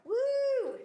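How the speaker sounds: high, loud "woo"; high-pitched cheer for the contestant; celebratory whoop. Real, not acted. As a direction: let out a long cheering whoop. Host cheers a correct answer.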